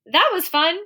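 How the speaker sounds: high-pitched happy exclamation; excited. Shown as a demonstration. Teacher showing students another excited statement, excited. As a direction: exclaim it with delight. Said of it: "Another excited statement".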